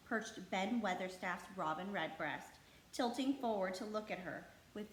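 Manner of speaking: ordinary delivery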